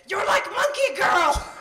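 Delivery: very high, loud, slow shouted exclamation; loud blunt waxer. Retold or imitated. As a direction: blurt it across the salon. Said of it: "very loudly looked at me and said".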